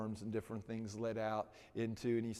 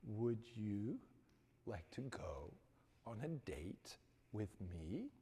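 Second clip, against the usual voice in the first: clear, quiet ask; 2 semitones lower, 9 dB quieter than usual. Retold or imitated. Johnny repeats his question to the stunned girl without stammering. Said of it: "with the most clearest voice".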